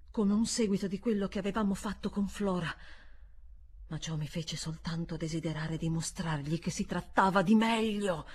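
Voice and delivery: plain reading voice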